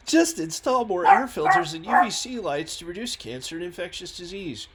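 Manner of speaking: high, loud mocking objection; mock objector. Voices critics' knee-jerk objection.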